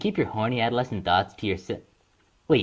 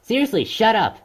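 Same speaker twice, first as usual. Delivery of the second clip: angry shout; 9 semitones higher, 4 dB louder than usual. Acted. Snapping at annoying friend.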